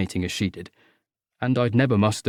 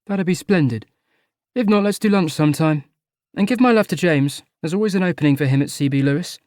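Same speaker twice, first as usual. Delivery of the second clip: posh city man; 6 semitones higher than usual. Acted. Charlie chats breezily at a party.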